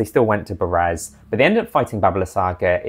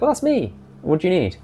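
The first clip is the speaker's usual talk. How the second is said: high casual reply; 11 semitones higher than usual. Retold or imitated. GM voices Fran replying.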